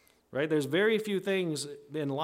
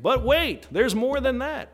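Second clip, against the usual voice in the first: much higher and louder, salesman building hype then settling; TV commercial pitchman; 4 semitones higher, 3 dB louder than usual. Retold or imitated. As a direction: sell it like an infomercial host. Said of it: "Paul is here, is like".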